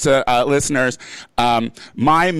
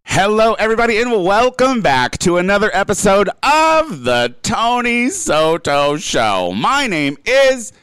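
show host intro; 6 semitones higher than usual. Acted.

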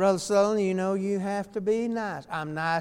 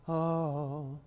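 mock pious moan, lower and much softer; old religious tone; 5 semitones lower, 9 dB quieter than usual. Preacher mocking relatives who phone in trouble with a pious moan. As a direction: imitate a churchy sigh, drawn out and hushed. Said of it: "get some kind of old religious tone in the voice".

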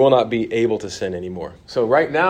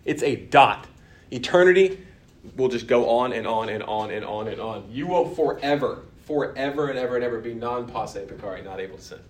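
normal talking voice

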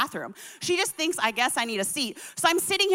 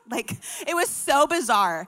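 ordinary talk

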